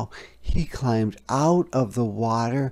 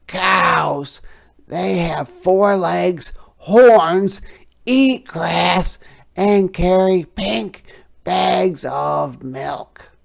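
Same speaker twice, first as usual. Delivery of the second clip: higher, louder frog voice, explaining; storytelling frog; 8 semitones higher, 8 dB louder, slower than usual. A narrator's reading. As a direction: describe it grandly as the frog. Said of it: "said the frog".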